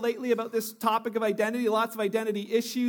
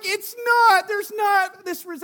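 a loud, high, flustered denial that trails off slower; flustered protest; 9 semitones higher, 7 dB louder, slower than usual. Retold or imitated. Pastor to the elevator crowd, loudly protesting. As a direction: protest it as if caught out. Denying resemblance to Ben Stiller.

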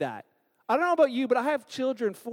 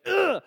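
high, loud annoyed ugh; disgusted groan; 8 semitones higher, 5 dB louder than usual. Retold or imitated. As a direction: groan like you refuse to listen. Instant barrier against criticism.

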